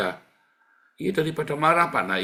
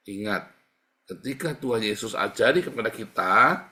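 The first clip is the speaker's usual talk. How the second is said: ordinary talk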